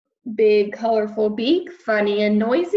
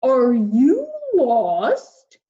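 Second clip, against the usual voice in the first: big, louder whale greeting; friendly whale voice; 2 semitones higher, 5 dB louder, much slower than usual. Acted. Whale greeting lost Peter, big and friendly. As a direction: boom out a kindly hello.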